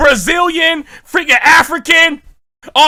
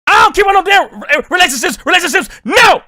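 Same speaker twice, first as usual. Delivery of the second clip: shouting back; 4 semitones higher, faster than usual. Real, not acted. Reacting to the song, shouting at [name].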